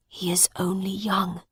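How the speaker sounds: soft unvoiced whisper. Miles to the woman, quiet whisper. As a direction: confide it under the breath. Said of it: "Miles whispered to the woman".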